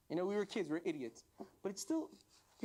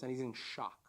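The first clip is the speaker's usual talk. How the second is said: normal talking voice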